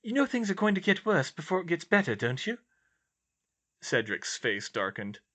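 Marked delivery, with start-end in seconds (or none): 0.0-2.6 higher-pitched character voice, earnest; raised, sincere dialogue voice; earnest young heroine. Acted. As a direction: voice the concerned friend, pitched up.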